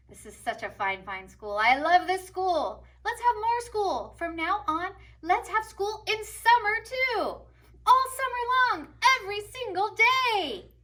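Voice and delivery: booming high proclamation, building; rousing head teacher speech; proud principal. A narrator's reading. Principal to students and teachers, grand and rising. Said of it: "and said".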